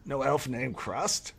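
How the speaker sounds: put-on high old elf scoff; scoffing elder. Old elf denying he knows Krusk. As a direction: dismiss the name like a grumpy elder.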